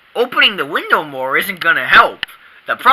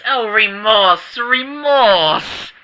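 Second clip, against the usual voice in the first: hammed-up remorse; 3 semitones higher, much slower than usual. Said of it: "My penitent sinner stick needs work".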